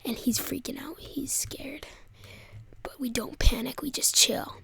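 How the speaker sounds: soft, tense whisper. Real, not acted. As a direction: hush it like hiding. Hushed tense narration.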